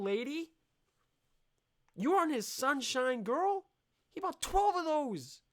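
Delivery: high, loud mocking warning. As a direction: scold the lady in a squeaky mocking pitch.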